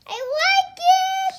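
high, loud, slow doll voice. Pretend. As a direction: squeak it out like a happy doll. Child voicing doll.

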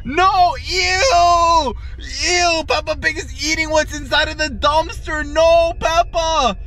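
high, stretched-out cries of disgust; grossed-out outcry. Pretend. Vlogger scolding Peppa for eating from the dumpster, grossed out. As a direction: wail it in exaggerated revulsion.

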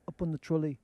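lightly put-on English accent; hint of an English accent; mock English accent. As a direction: imitate English speakers, a short vowel in trolley. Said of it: "They, no, they say".

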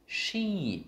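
"sheep" high, then sliding steeply down; long emphatic "sheep" with a big pitch glide; throat-voice drill. Shown as a demonstration. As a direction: swoop down through the vowel of "sheep". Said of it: "hãy có lên giọng xuống giọng".

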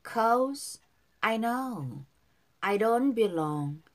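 very slow, drawn-out English phrases; slow model reading. Shown as a demonstration. Teacher models song lyrics for learners, slow and drawn out. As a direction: stretch each word for learners to follow. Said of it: "바로 밑에 이 절 발음 따라서".

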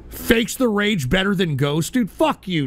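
ordinary delivery

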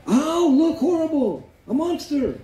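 very high-pitched hammed-up alarm; mock terrified cry. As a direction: wail it out high as a mother faking horror. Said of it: "и вдруг закричала".

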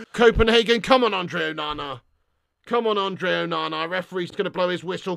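low, hushed plea to the keeper; tense pleading. Real, not acted. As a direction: urge him on under your voice before the kick. Tense plea before penalty kick.

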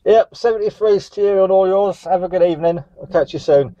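usual speaking voice

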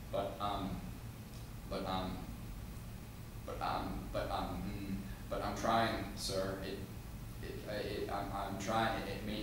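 soft, halting, nervous pleading; meek stammering confession. Acted. Young boy confesses to his stern father, meek and hesitant. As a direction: stumble through it quietly, restarting words.

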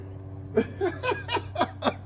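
a real high laugh; high breathy laugh. Real, not acted. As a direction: laugh high at the dog's fussiness. Owner laughs at dog.

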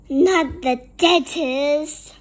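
loud, slow, drawn-out refusal; acted protest at full volume; gritted protest. A narrator's reading. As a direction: dig in your heels, stretching each word. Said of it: "Louis gritted".

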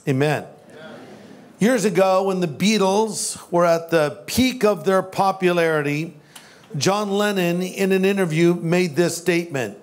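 ordinary delivery

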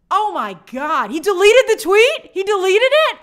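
high, loud gasping disbelief; can't believe he deleted it; shocked disbelief. Retold or imitated. Host retelling his reaction to the deleted tweet, loud shocked disbelief.

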